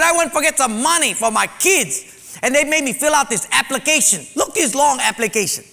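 a worked-up man demanding help; angry complaint. Retold or imitated. Angry man at church office.

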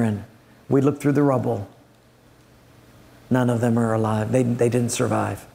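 low, heavy sorrowful voice; somber messenger. Acted. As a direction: break the bad news gently and heavily. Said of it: "comes in somber, looking very, very down".

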